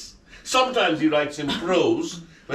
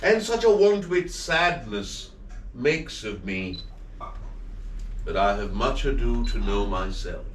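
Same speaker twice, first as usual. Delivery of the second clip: measured theatrical recitation; recited Shakespeare; 4 semitones lower than usual. Acted. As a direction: read it as stage verse, weighing each phrase. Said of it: "as I recited those lines".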